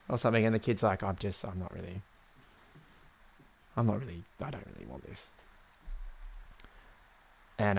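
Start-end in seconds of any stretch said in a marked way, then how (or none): 1.0-5.3 very soft, lower, halting refusal; small, subdued mumble of reluctance; reluctant kid. Acted. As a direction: back out quietly like an unimpressed child. Acts the unimpressed sick kid.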